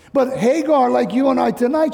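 usual speaking voice

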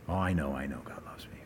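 low, soft, half-whispered reply; under the breath, brushing it off; dismissive murmur. Acted. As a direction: mumble it as a listener who has heard it all before. Acting a listener brushing off reminder.

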